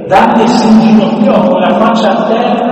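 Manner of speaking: loud, full-voiced Bible reading; scripture reading. Preacher reading the David story aloud, proclaiming. Reads David's speech to Saul.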